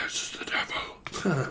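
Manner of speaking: slow, eerie whisper; hushed, drawn-out sinister reply; whispering devil. Acted. Devil answers the phone.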